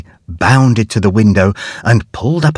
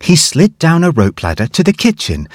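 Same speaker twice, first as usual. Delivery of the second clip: usual speaking voice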